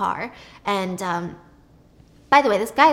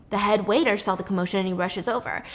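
normal talking voice

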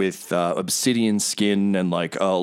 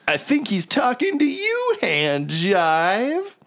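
mocking hero; 9 semitones higher, slower than usual. [name] mocks Hand Jive.

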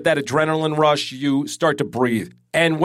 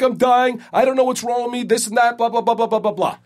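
higher, rushed mock panic, trailing into blah blah; panicked call to mother; 7 semitones higher than usual. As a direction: imitate yourself begging mom for help. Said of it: "I'm like".

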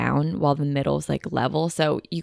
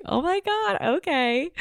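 excited; 11 semitones higher than usual. Retold or imitated. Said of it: "And I was like".

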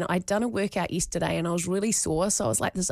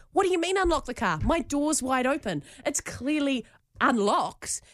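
high, fast, exasperated protest that slows at the end; irate; 6 semitones higher than usual. Retold or imitated. Wife arguing with her husband over the petrol cap, irate. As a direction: retell the annoyance, half mocking it. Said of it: "I'm just getting so irate and annoyed".

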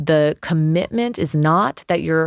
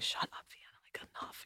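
hushed inner scolding whisper; a breathy whisper telling herself to stop; whispered to self; 23 dB quieter, faster than usual. Retold or imitated. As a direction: whisper it to yourself, fed up. Inner voice scolding herself mid-argument.

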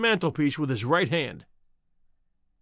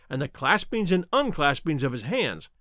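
ordinary delivery, nothing marked

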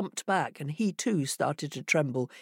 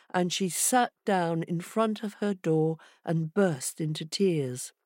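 ordinary delivery

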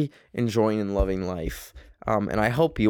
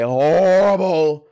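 much louder on horrible, horrible; emphatic complaint; 5 semitones higher, 6 dB louder, slower than usual. Real, not acted. Podcaster complaining about sleeping where she films, loud. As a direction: lean hard into the repeat.

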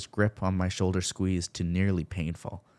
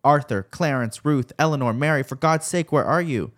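high, loud desperate calling of names; 7 semitones higher, 7 dB louder than usual. A narrator's reading. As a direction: shout the names into the dark. Mother calling lost children.

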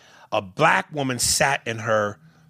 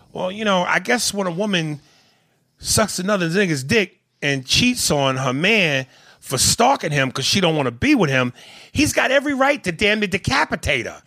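sarcastic; 7 semitones higher than usual. Acted. Sarcastic mock of others' logic.

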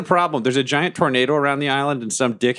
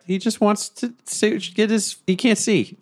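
high pleading; 10 semitones higher than usual. Real, not acted. Player pleads for the creature.

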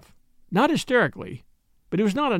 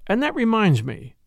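ordinary delivery, nothing marked

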